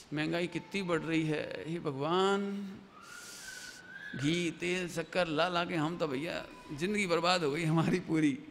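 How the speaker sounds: lightly voiced weary household complaint; grumbling spouse. Husband and wife alone grumbling about prices, mock lament.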